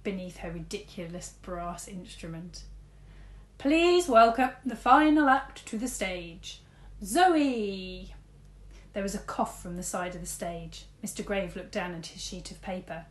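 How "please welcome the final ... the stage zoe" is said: announcer. A narrator's reading. Headmaster announces act.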